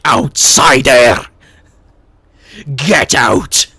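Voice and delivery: loud, slow, hostile locals; hostile shout. Retold or imitated. Barman shouting at an outsider, slow hostile yell. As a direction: bellow them out of the pub. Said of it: "turn their nose at me".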